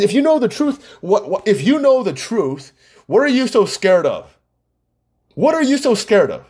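high-pitched, insistent questioning; re-enacted pointed demand; retold challenge. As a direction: press the question hard, pitch lifted. Retold quarrel, he challenges his friend.

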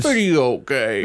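announcer echo. Acted. Echoing the segment title.

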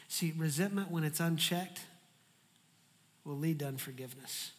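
ordinary talk

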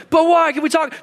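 put-on very high protesting kid; mock child pleading. Punished child asking parent to talk, high mock pleading. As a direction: plead back in a high little voice.

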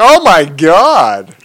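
loud, drawn-out hammed-up awe; mock starstruck. Man retelling meeting the hall's owner, mock starstruck gasp of words. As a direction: stretch it out as if meeting a celebrity.

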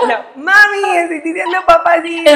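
loud and high, laughing through the words. Real, not acted. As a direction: burst out laughing while telling mom. Laughing at her own success story.

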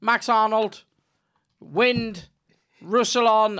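high, loud commentator naming players; hammed-up touchline-to-touchline name-calling; imitating football commentator. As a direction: call each pass by surname, mock commentary. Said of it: "he'd be like".

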